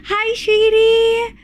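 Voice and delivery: high, slow sing-song hello; cutesy coo. Real, not acted. Streamer cooing at a chatter, high and slow. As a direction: stretch it sweetly upward.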